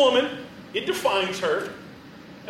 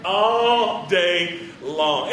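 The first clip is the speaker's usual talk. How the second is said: very slow lazy all day long; slow drawn out; 4 semitones lower, much slower than usual. Acted. Preacher savoring a lazy morning, very slow and drawn out. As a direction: stretch each word, savoring. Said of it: "drawn-out echo".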